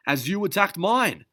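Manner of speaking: high, loud angry retort; furious comeback; snarled. Acted. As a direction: snarl it back at her. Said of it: "he snarled".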